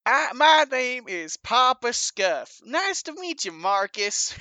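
high, emphatic character voice; big, lilting in-character introduction; Papa Scuff, overfriendly stranger. Acted. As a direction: hail a stranger with hammy friendliness.

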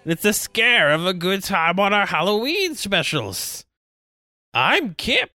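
high, cackly spooky host voice; a loose Crypt Keeper impression. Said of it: "that wasn't the Crypt Keeper in the slightest".

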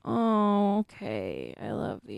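drowsy, nearly asleep; half-asleep murmur; sleepy. Real, not acted. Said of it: "I'm, like, falling asleep".

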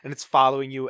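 normal talking voice

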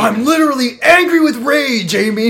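high, loud mock rage; hammed-up angry outburst; Ricky impression. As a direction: act out an over-the-top furious boyfriend. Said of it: "a pretty good at Ricky impression".

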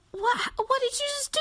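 very high, aghast squeal; high-pitched mock horror; horrified disgust. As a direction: imitate an appalled stranger. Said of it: "disgusted, she's horrified".

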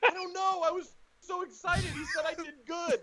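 very high, silly self-mocking whine; excited dumbass. Comic explaining himself to his manager, like an excited dumbass. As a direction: play yourself as a giddy simpleton, squeaky high. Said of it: "Like I'm a dumbass".

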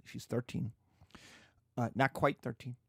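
ordinary delivery

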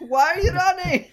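high, loud mock call. Mom calling after her running son, loud mock holler. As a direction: holler it after someone fleeing.

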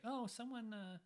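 a hint of a sulky crying ghost; whiny ghost girl.

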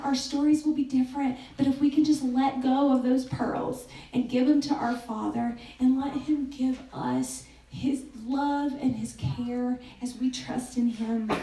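ordinary talk, nothing marked

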